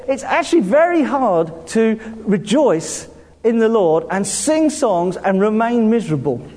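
normal talking voice

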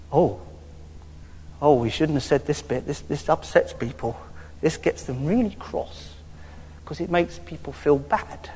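playful stage-whisper aside; mock hushed aside. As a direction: drop the voice as if sharing a guilty secret. Mock confession of a touchy point.

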